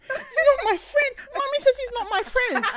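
put-on very high, loud little child's voice, laughing; toddler voice. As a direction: squeak it like an offended toddler. Son repeating what mum said.

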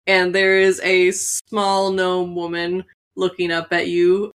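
usual speaking voice

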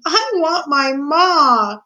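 high, slow, long-held wail; desperate girl wailing for her mother; crying out. A narrator's reading. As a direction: let the longing pour out in a drawn-out cry. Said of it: "cried Mig into the silent".

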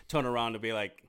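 usual speaking voice

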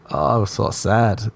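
ordinary delivery

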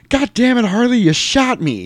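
high, loud acted-out cry of pain; movie quote. Quoting the movie to his wife, a loud high cry. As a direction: yell it like a wounded biker.

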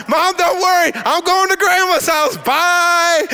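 high, singsong gleeful farewell; a carefree kid calling goodbye to mom; excited child. Retold or imitated. Thrilled child says goodbye to mom.

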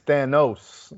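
Thanos said slowly and carefully, no T before the s; Thanos without extra T. Shown as a demonstration. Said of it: "Let's take that extra T out".

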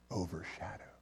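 overshadowed breathed as a soft unvoiced whisper; whispered word. Shown as a demonstration. Preacher showing the congregation a favourite word, whispered. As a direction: whisper overshadowed so the room leans in. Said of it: "I just want you to hear that word overshadow".

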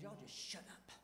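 low, hushed scolding, bitten off; quiet stern rebuke. Retold or imitated. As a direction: tell them to shut up in a quiet hard voice. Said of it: "the anger of God's come on him".

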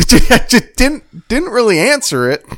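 high, loud words broken by laughter; laughing. Real, not acted. Laughing after a dry comeback.

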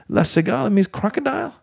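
high, disbelieving query; incredulous question. Retold or imitated. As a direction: ask in high mock disbelief. Retold question to bellman.

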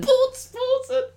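very high, slow squeaky echo; squeaky silly voice. Pretend. Friend echoing a silly name in a squeaky voice.

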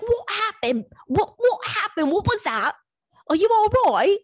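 high, put-on groggy-confused man voice; mocking impression of a baffled bedmate; confused man woken up. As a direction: imitate his bewildered questions way up high. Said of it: "very confused".

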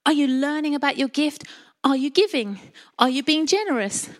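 normal talking voice

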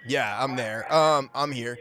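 normal talking voice

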